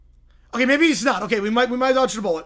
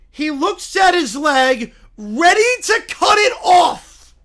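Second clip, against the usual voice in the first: dramatic build-up; 6 semitones higher, 8 dB louder, slower than usual. Acted. Dramatic moment, leg cut.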